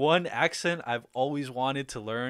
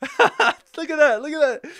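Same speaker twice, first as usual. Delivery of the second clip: delighted laughing squeal, much higher and louder; excited; 11 dB louder than usual. Real, not acted. Streamer reading a fan's sweet message, squealing with delight. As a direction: gush over the message, laughing.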